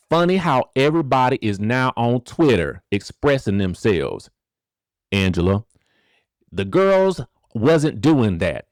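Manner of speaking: twangy Southern read of her tweet. Retold or imitated. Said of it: "You gotta read it in the [name] voice".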